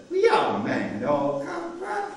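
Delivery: loud, high mock southern accent; hammed-up southern drawl, nearly unintelligible to a foreigner. American soldier talking to a young refugee, mock southern accent. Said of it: "southern accent, I never heard such English".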